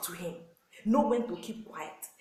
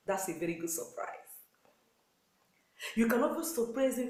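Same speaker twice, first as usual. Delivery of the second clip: ordinary talk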